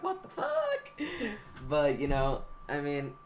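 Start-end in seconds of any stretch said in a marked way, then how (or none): 0.0-0.9 sudden high squeal of disbelief; shocked outburst. Retold or imitated. Gamer seeing his first trick shot video's dislikes, squealing in shock. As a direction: jump way up in pitch, stunned. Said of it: "I was just like".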